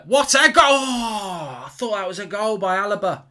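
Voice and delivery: sudden loud, high cry at a near goal; excited shout. Real, not acted. Fan watching a long-range strike, shouting excitedly. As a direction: leap up at the goal.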